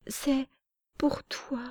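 high, soft and airy, barely voiced; breathed-out child's words. Little girl breathing out her offering to Parmione, high and soft. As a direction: let three small words out on one breath. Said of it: "expira trois mots".

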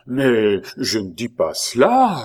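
slow protest leaping up at the end; alarmed cry. A narrator's reading. Pitou horrified at the accusation, protesting. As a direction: cry out in dawning fright. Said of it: "s'écria Pitou, commençant à s'épouvanter".

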